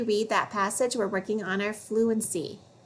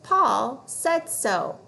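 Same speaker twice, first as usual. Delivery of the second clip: "Paul said so" slow with weight on "Paul"; slow fluency read; 2 semitones higher, much slower than usual. Shown as a demonstration. Teacher reading a fluency phrase slowly. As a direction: model "Paul said so" slowly, stressing "Paul".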